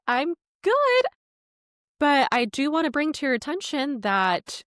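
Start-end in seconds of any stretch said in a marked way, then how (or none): 0.1-1.1 high sing-song manager's reply; polite phone reply. Retold or imitated. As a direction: pitch it way up, politely upbeat.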